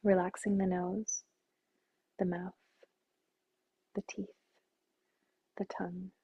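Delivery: soft, soothing meditation voice with long pauses; guided meditation. Acted. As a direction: gently guide them through relaxing the body.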